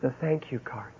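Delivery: lightly voiced stunned whisper of discovery; hushed realization. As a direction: breathe it out like a sudden insight. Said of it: "and he went".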